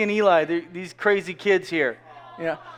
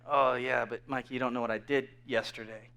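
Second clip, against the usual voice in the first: doubtful mumble; 9 semitones lower, 11 dB quieter than usual. Retold or imitated. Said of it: "You're like".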